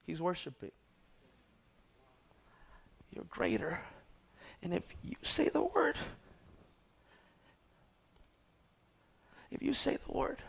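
hushed worship. Acted. Pastor quieting the church after the climax, near whispered. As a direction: drop to a reverent hush.

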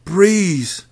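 plain reading voice

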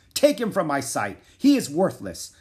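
loud, high dismissive command; angry shout. A narrator's reading. King shouting to have him taken away, angry. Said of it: "shouted the king".

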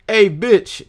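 loud mocking catcall. Friend imitating a loud catcall at the mall. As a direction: yell it across the mall like a jerk.

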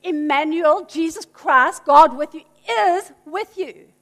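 normal talking voice

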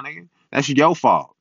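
normal talking voice

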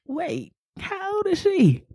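high, slow, baffled question; drawn-out high-pitched mock bewilderment; imitated disbelief. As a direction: play a puzzled guest, stretching it out. Baffled wedding guests, imitated.

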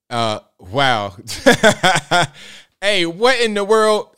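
high, laughing disbelief; stunned disbelief. Real, not acted. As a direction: react in shocked amusement.

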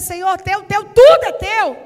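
emphatic declaration. Retold or imitated. Pastor proclaims the song line at full force. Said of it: "Porque pra eu dizer".

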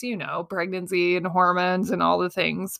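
usual speaking voice

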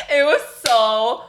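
drawn-out words through helpless laughter; slow, half-laughing lament; laughing in disbelief. Real, not acted. Laughing over painful memory.

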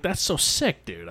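high-pitched, impressed exclamation; excited reaction. Retold or imitated. Retelling own reaction to story.

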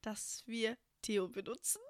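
ordinary talk

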